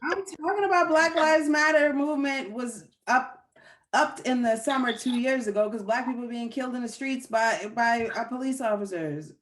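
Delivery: tipsy, loud rant. Real, not acted. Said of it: "It's a junk rant".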